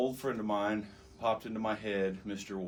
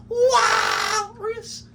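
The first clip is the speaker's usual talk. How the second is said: long high shouted call; Walrus call; 14 dB louder than usual. Retold or imitated. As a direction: holler it together, stretched and rising. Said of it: "we're like".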